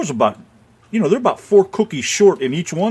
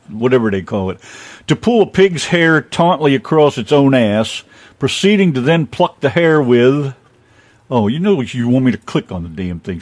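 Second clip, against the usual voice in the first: ordinary talk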